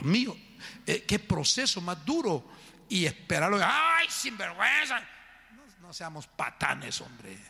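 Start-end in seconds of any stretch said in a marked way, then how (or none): 3.6-5.0 high-pitched outburst of mock outrage; mock scolding. Pastor imitates a judgmental reaction to a confession, mock scolding.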